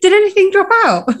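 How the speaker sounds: asked high through real laughter; laughing. Real, not acted. Asking the co-host about the dress while laughing. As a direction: squeak it out while cracking up.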